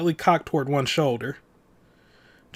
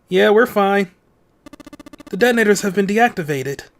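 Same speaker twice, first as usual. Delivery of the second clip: raised, higher voice carrying through a door; calling back; 6 semitones higher, 5 dB louder than usual. Acted. Man answering friends through a closed door, calling back. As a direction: call out loudly to reassure them.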